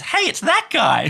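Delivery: put-on high, slow kid's voice; child voice. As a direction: point and call like a little kid. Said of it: "literally says".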